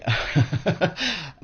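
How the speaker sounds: short wry chuckle, trailing off; rueful laugh. Real, not acted. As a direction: laugh off the bitter joke. Wry aside about apartheid.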